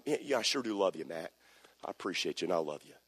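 soft, quick, affectionate murmur of love; tender encouragement. Retold or imitated. Pastor to a youth, softly murmuring affection. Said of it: "I'll go up and I'll say".

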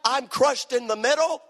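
ordinary talk, nothing marked

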